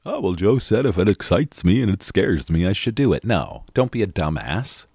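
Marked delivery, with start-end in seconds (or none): 0.1-3.2 louder, put-on dopey voice; mock-dumb quote; mocking a foolish viewer. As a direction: parody the person who misread him. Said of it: "Don't just go jump".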